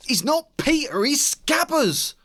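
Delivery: high, loud yelled denial. A narrator's reading. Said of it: "Ron yelled".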